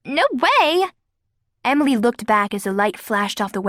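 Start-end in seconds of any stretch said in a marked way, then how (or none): 0.1-0.7 put-on high protesting girl; indignant refusal. A narrator's reading. Kara refuses to bend.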